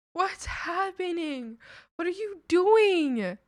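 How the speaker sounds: high, loud, slow bewildered yelling; drawn-out shout. Real, not acted. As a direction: stretch each word out in disbelief. Freakout at random names.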